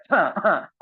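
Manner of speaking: short, put-on double cough; demonstrated cough. Shown as a demonstration. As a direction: cough as if clearing something from the airway. Teacher acts out coughing something out.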